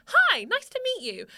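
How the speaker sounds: high, loud bubbly greeting; confident bubbly person. Acted. Greeting strangers at an event, confident and bubbly. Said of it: "put on the confident, bubbly person".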